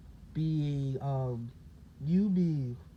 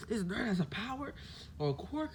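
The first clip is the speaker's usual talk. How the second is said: higher and softer, mock disbelief; shocked disbelief; 7 semitones higher, 5 dB quieter than usual. Bakugo can't believe the nerd has power, shocked. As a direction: play it like it can't be true.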